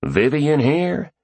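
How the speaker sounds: slow, high, rising Southern drawl. A narrator's reading. Stranger asks at the door for Vivian, Southern drawl. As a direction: stretch it into a Southern question. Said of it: "the man said in a strong southern drawl".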